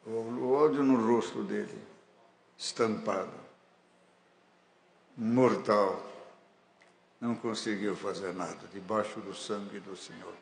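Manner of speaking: ordinary talk, nothing marked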